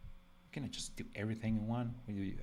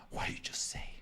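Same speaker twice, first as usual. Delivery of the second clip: shocked whisper of an offended Italian; whispered mock disbelief; 10 semitones higher than usual. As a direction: hiss it out in mock outrage. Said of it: "some Italians are going to be like".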